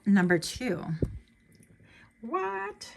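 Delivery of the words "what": sudden high-pitched "What?"; jolted, thin soft squeak of surprise; a startled high little "what"; surprised squeak. Real, not acted. Startled reaction to the next card.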